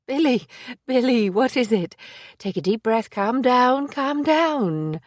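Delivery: steady him while your own nerves race; urgent alarm. Acted. Maisie to breathless Billy, anxious and urgent.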